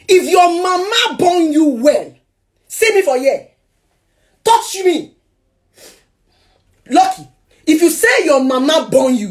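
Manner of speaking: high, loud, drawn-out daring; furious challenge. Retold or imitated. Wife holding iron to fleeing ex, furious challenge. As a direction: dare him at full pitch, word by word.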